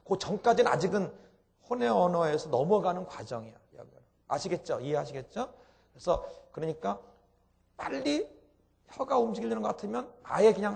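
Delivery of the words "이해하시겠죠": usual speaking voice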